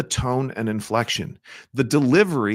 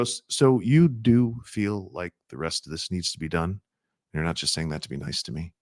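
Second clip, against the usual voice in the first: light teasing tone; 6 semitones lower than usual. Shown as a demonstration. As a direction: tease them gently so they smile. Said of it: "We do it with our tonality".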